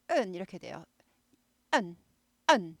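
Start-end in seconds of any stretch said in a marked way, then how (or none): 1.7-2.8 은 pushed from the throat like a bark; 은 as a throaty barked nasal. Shown as a demonstration. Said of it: "짖어야 된다 그랬죠".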